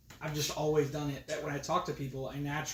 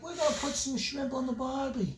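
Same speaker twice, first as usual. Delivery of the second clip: broad Aussie voice, pitched up; Australian accent; 10 semitones higher, 3 dB louder than usual. Retold or imitated. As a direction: do a cheesy Australian. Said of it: "I got the Australian".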